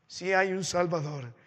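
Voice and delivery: ordinary delivery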